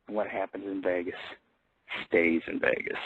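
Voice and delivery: whispered, the secret of Sin City; soft whisper of the old motto; hushed Vegas secret. Acted. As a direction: breathe it like a conspiracy.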